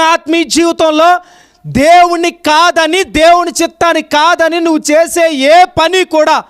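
high, forceful pulpit rebuke; full-force preaching. Real, not acted. Preacher rebuking believers who ignore God's will, full-force preaching.

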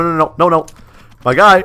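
normal talking voice

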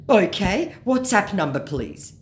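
scammer. Acted. Scammer asking for a phone number, mock insistence. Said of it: "he says".

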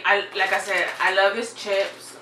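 ordinary delivery

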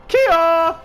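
high, loud martial-arts yell; battle cry. Pretend. Gamer charging into a fight, battle cry. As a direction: let out a sharp high "kiai" shout.

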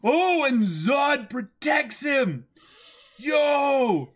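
high, loud and slow with excitement; hyped shout. Real, not acted. Reading the fight panel to his cohost, hyped and shouting.

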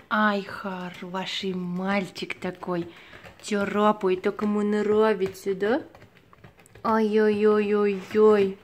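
slow cooing praise for a pet; squeaky praising a dog. Real, not acted. Praising the cat scratching.

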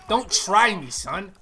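loud mock threat at the screen. Real, not acted. Gamer threatening an enemy player, loud. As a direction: bark it at the enemy.

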